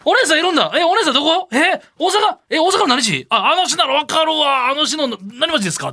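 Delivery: quick, eager prying voice in Kansai speech; fully in character. Shown as a demonstration. Partner shows how to read the nosy guest's questions, fully in character. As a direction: become the nosy woman, rattle off the questions. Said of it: "憑依してくれんと。".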